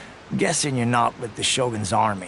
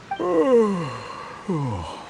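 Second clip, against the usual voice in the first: long contented sigh; 6 semitones higher than usual. Acted. Savoring the sun and breeze.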